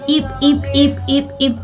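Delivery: high, soft, slow little yips; soft puppy yips. Acted. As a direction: squeak like a tiny puppy. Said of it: "वो धीरे धीरे बोलता है". (पिल्ले की तरह धीरे धीरे ईप ईप)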